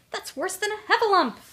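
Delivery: high, loud wail of dread; panicked little Piglet; terrified. A narrator's reading. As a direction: squeal it in alarm. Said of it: "began shaking with fear".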